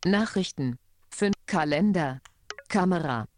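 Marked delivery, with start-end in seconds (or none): none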